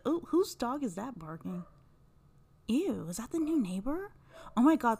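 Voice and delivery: hushed aside. Real, not acted. Distracted by neighbor's barking dog.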